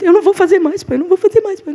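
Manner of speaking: put-on pleading crying child. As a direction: beg like a scared little boy. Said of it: "eu já começava a chorar". (menino choroso implorando, voz mais aguda)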